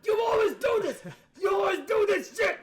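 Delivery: high, loud mock shouting; drunken neighbor screaming. Drunk neighbor yelling at his wife, screaming. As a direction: holler it like a raging drunk husband. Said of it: "but he screamed".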